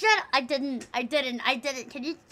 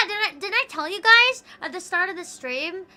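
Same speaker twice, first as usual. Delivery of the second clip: normal talking voice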